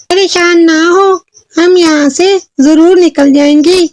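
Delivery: very high little fairy voice; little fairy reassuring. Acted. Little fairy reassures trapped girl, high fairy voice. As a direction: comfort in a tiny, high voice. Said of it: "एक छोटी सी परी आई".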